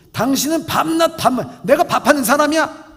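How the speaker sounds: loud, high indignant retort; indignant wife. Acted. Wife loudly retorts to husband asking for food. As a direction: fire back at him.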